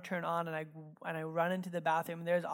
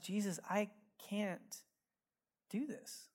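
soft, slow confession in prayer; humbled plea; 10 dB quieter than usual. Retold or imitated. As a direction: let it out quietly, helpless, pausing midway.

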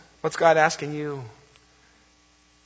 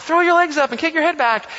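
higher and louder coaching call; calling instructions; 10 semitones higher, 5 dB louder than usual. Retold or imitated. Campers calling up to the girl on the zip line, loud instruction. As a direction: shout up encouragement to the rider. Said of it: "they're telling her".

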